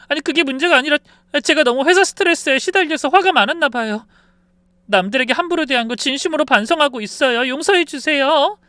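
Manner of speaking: high, loud whimpering apology; tearful pleading. Acted. Daughter-in-law apologizing to mother-in-law, tearful begging. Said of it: "유나도 울먹이면서 말했어요".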